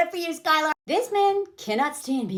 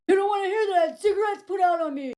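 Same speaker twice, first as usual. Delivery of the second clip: high, put-on anguished rant; imitated yelling; 6 semitones higher than usual. Man revealing his abuse to her, mock yelling. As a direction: mimic his tirade in a strained voice. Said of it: "he's yelling all of this".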